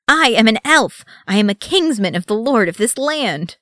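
high, loud indignant shout; furious wounded pride; angry outburst. A narrator's reading. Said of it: "flush of anger".

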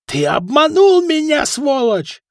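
very high, loud screeched accusation; furious shriek. A narrator's reading. Foka rushing at the swindler, furious shriek. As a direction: screech it at the man who cheated you. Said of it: "завизжал Фока".

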